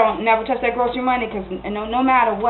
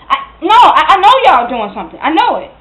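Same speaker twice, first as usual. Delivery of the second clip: heated, hurt ultimatum; angry accusation; 3 semitones higher, 4 dB louder than usual. Acted. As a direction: insist you know, then warn Darnell off. Acted quarrel, accusation and threat to leave.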